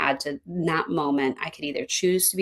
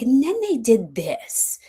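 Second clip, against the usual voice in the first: higher, drawn-out indignant outburst; triggered complaint; 5 semitones higher, slower than usual. Acted. Coach acting out a client hitting their trigger, indignant. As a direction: play the client getting worked up, slowly.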